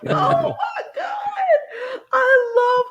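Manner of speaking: very high, loud, slow laughing cry; laughing shriek. Retold or imitated. Fan recalling her reaction, squealing with laughter. Reliving her delighted scream.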